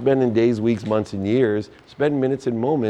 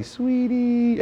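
a hint of a gentle teacher mom, much higher; sweet kindergarten-teacher mom; 12 semitones higher than usual. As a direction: coo it like a kindly kindergarten teacher. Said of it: "She's a consummate kindergarten teacher".

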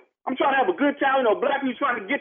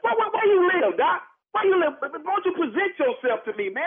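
much higher, indignant demand; outraged challenge; 5 semitones higher than usual. Real, not acted. Tailgater confronting the anonymous caller, outraged challenge. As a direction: demand to know who dares threaten you.

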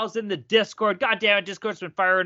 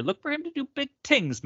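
ordinary talk, nothing marked

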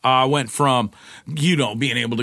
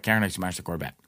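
low, softer admission; retold sheepish confession; 4 semitones lower, 6 dB quieter, faster than usual. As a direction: confess it quietly to the girlfriend.

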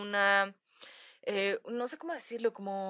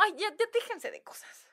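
exasperated aunt; 6 semitones higher, 4 dB quieter than usual. Retold or imitated. Aunt dismissing ghost talk.

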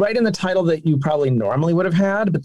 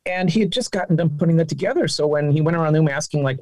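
normal talking voice

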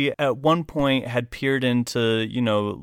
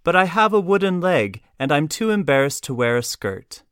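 raised, higher and sugary, for the whole room; honeyed excuse pitched up and out loud; sweet but projected; 6 semitones higher, 4 dB louder than usual. Retold or imitated. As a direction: sweetly project it so every customer hears. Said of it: "I said sweetly, but projecting".

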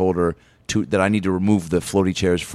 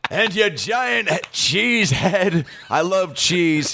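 mock rant; 7 semitones higher, much slower than usual.

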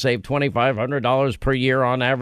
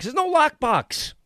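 scoffing; 11 semitones higher than usual. Real, not acted. Sarcastic punchline.

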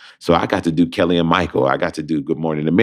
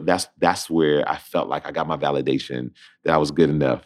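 ordinary delivery

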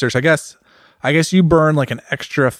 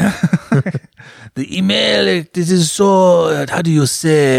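put-on French accent; 3 semitones higher, slower than usual. Fumbling the email plug, bad French accent. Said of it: "I can't do a French accent to save my life".

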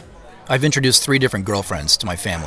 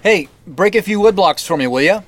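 put-on higher and louder, cheerful call after the kids; 10 semitones higher, 5 dB louder than usual. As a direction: shout a friendly send-off across the room. Calling after departing kids.

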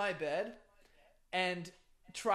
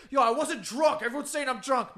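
higher, louder mock protest; retold indignant yelling; 6 semitones higher, 6 dB louder than usual. Friend denying he was drunk, retold as indignant yelling. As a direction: imitate a defensive, mildly yelled denial. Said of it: "he was getting mad at us and yelling".

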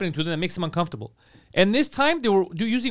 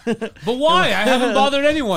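higher, louder mock protest through laughter; hammed-up hurt innocence; mock indignant tenant; 5 semitones higher, 6 dB louder than usual. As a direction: play the wronged innocent tenant. Mock-victim of Christmas card eviction.

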